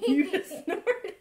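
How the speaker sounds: high and loud, slow, laughing through it; amused disbelief at the snort. Real, not acted. Mother laughing at her son's snort, high and loud.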